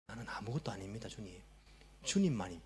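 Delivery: soft, low surrender; humble prayer. Acted. Acts out a self-emptying prayer.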